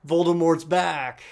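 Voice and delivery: loud, drawn-out mock proclamation; blurted announcement. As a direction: blurt it out as exaggerated big news. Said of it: "blurt it out".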